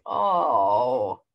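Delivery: ordinary delivery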